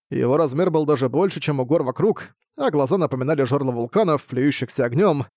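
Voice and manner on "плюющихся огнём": ordinary delivery, nothing marked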